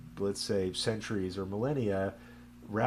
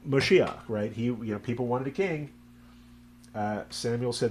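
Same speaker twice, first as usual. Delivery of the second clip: normal talking voice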